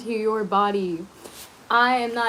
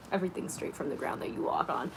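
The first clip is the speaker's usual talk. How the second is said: muttered aside; 3 semitones lower, 11 dB quieter, faster than usual. Real, not acted. Muttered retort to critics.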